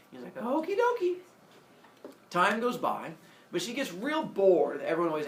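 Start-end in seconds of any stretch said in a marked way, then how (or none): 0.4-1.2 high sing-out reply; lightly acted, high and perky; chirpy agreement. Semele agrees to Zeus's plan, high and chirpy. Woman cheerfully agrees in retold dialogue.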